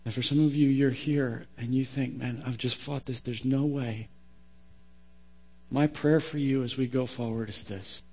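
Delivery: low, hushed sermon close; prayerful preaching. Real, not acted. Preacher praying for struggling listeners, hushed and earnest.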